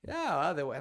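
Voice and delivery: high, loud sarcastic droid; John Mulaney. Acted. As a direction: refuse in an old cartoon clown voice. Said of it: "I made John Mulaney sound".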